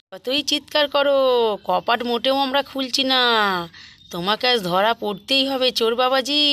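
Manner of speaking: loud, angry yelling through the door; defiant shouting. Acted. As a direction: shout the thief down, furious and unafraid. Sisters yell at trapped thief.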